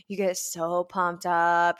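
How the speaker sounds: normal talking voice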